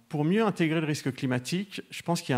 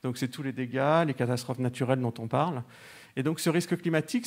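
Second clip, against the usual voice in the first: ordinary talk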